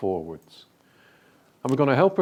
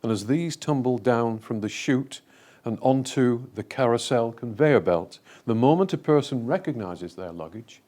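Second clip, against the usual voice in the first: measured, lower meditation voice with long pauses; guided meditation; 6 semitones lower, 5 dB quieter than usual. Real, not acted. Speaker leading a group through a visualisation, guided meditation voice. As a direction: guide listeners through an imagined scene, phrase by phrase.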